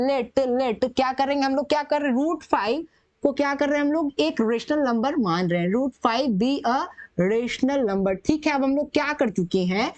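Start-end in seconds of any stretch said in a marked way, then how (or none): 5.7-8.1 very slow, word by word as he writes; slow dictation. Shown as a demonstration. Teacher writing the assumption for students, slow dictation. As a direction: spell the line out slowly to the class.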